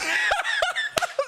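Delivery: shrill put-on laugh; high mocking cackle. Streamer cackles after mocking the Twitter crowd, high laugh.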